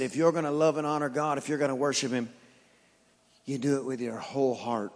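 soft low retelling; quiet. Retold or imitated. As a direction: drop it to a hush. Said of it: "He said".

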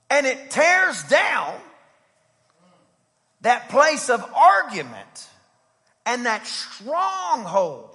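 loud, high preaching build; fiery preaching. Acted. Preacher rousing the congregation with a loud charge. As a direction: preach it hard to the church.

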